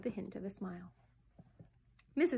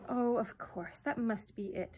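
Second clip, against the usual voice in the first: ordinary delivery, nothing marked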